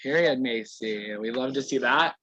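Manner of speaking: ordinary talk, nothing marked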